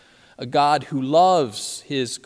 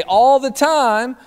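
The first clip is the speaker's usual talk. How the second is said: louder, higher, drawn-out call rising at the end; camp director's yell; 11 semitones higher, 9 dB louder, slower than usual. Retold or imitated. Camp director calling back to the campers, high rising yell. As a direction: throw it back out to the room. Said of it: "And then Dave would say".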